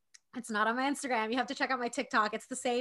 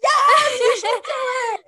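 excited shout; 13 semitones higher, 14 dB louder than usual. Real, not acted. Cheering friend's pink hair idea.

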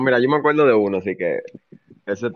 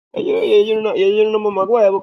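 higher, heated complaint; worked-up rant; 9 semitones higher than usual. Real, not acted. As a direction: vent about the teacher. Ranting about the professor.

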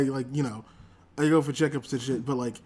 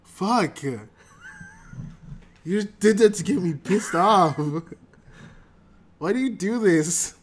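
annoyed outburst; 5 semitones higher, 3 dB louder than usual. Real, not acted. Co-host keeps derailing intro.

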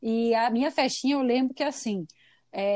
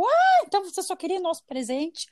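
mock accusation; 7 semitones higher than usual. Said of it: "elas ficaram tipo".

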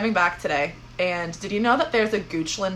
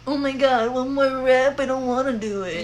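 lightly voiced weary old lady, a little higher; grandma voice; 5 semitones higher than usual. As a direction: groan it like a tired granny. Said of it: "It's like my grandma voice".

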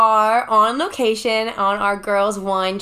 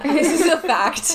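laughing; 5 semitones higher than usual. Real, not acted. Laughing at the joke.

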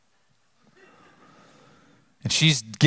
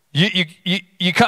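ordinary talk, nothing marked

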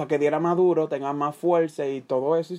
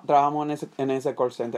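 usual speaking voice

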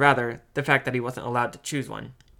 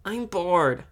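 put-on higher-pitched childish complaint; bored little sister; 6 semitones higher, 3 dB louder than usual. A narrator's reading. Little sister complains to brother on the train, bored. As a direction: pester like a bored kid.

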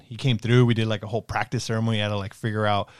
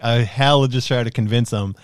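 ordinary delivery

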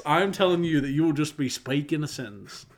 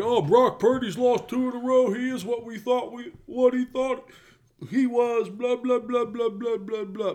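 higher, dismissive mock voice of the critics; 8 semitones higher than usual. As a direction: lightly parody the doubters. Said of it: "People are like".

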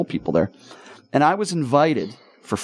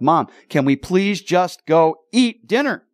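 slow, emphatic, higher-pitched plea at the end of patience; retold exasperation, spacing out each word; exasperated fury; 6 semitones higher, slower than usual. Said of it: "I am furious".

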